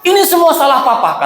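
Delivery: hammed-up wife scolding; mock blaming wife. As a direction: blame the husband, half laughing. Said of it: "kata istri".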